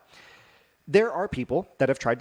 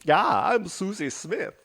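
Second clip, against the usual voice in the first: slow, higher mock German accent; 7 semitones higher, 4 dB louder, slower than usual. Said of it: "It's my German accent there".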